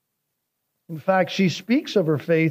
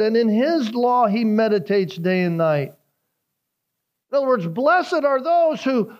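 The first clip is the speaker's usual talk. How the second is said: fervent preaching; 6 semitones higher than usual. Acted.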